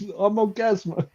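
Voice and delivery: high-pitched superhero self-introduction; mock superhero. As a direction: announce yourself as a silly caped hero. Acting out superhero announcing his name.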